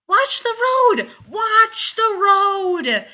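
very high, drawn-out yell; full-out terrified shout; panicked scream. Acted. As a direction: scream a warning, stretching it longer the second time. Panicked warning in speeding car.

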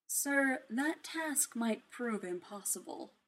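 lightly voiced butler AI, soft and slow; a hint of a careful butler warning; softly cautioning AI voice. A narrator's reading. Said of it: "Jarvis cautioned".

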